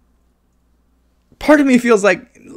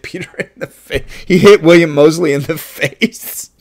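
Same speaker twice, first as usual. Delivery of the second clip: laughing; 4 semitones higher, 7 dB louder than usual. Real, not acted. Film fan sharing an on-set mishap, laughing loudly. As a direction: burst out laughing while telling it, louder.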